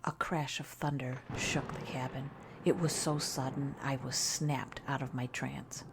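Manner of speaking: plain reading voice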